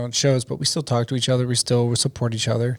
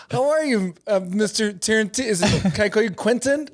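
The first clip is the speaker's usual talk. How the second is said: nervous fawning; 6 semitones higher than usual. Retold or imitated. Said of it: "And I go".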